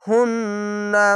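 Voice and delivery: very slow হুন্না with a long held nasal ন্ন; nasal ghunna. Shown as a demonstration. Teacher shows the obligatory ghunna on হুন্না. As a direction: hum through the ন্ন of হুন্না slowly. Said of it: "এখানে ওয়াজিব গুন্না".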